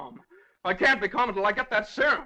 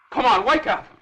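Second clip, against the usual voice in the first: loud call to wake a sleeper; urgent rousing shout; 4 semitones higher, 7 dB louder than usual. Acted. Man rousing the sleeping judge, urgent shout. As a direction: shout him awake, pitch climbing.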